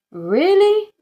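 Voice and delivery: stunned disbelief; astonished really. A narrator's reading. Dad and inspector astonished at Tugg's change of heart. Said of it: "chorused Dad and Miss Rice".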